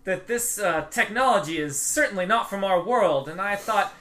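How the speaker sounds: proudly explaining her secret project; eager tinkerer Stoila. Acted. GM voices NPC Stoila.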